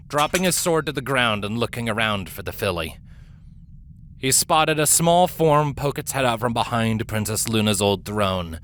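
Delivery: usual speaking voice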